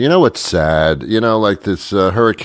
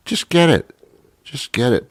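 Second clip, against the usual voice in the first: usual speaking voice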